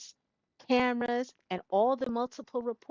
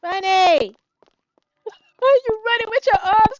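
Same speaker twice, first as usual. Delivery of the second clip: high, loud exasperated yell; 13 semitones higher, 16 dB louder than usual. Acted. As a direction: holler after her. Yelling after pouting Bunny.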